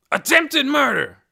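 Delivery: slow, loud, high shout; loud charge. A narrator's reading. Officer shouting the charge at Hoyt. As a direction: hammer out the charge.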